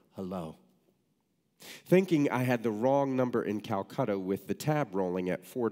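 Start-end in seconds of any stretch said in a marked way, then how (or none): 0.1-0.6 put-on raspy old woman mutter. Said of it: "an old, raspy voice ... mutter".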